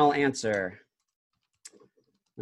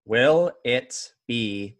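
dramatic reality show hype; 3 semitones lower, slower than usual. Acted. Host builds game-show suspense.

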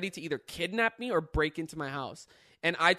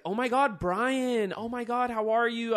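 higher, louder excited girl imitation; mock gushing greeting; 6 semitones higher, 6 dB louder than usual. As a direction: gush like an overfriendly stranger. Stranger girl greets him, retold.

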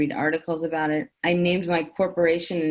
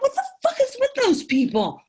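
mock outrage. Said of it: "I was like".

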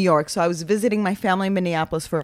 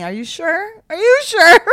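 rising from high to higher and louder; mock insistent questioning; 9 semitones higher, 7 dB louder than usual. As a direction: press the doubt harder on the repeat. Retold feigned doubt, pressing him.

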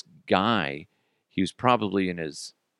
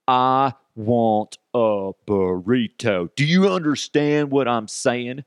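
slow, talking down, louder; condescending hillbilly; 3 semitones higher, 4 dB louder, slower than usual. Retold or imitated. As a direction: spell it out like she's a child. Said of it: "like, total, like, hillbilly".

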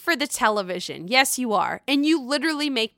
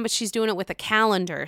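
usual speaking voice

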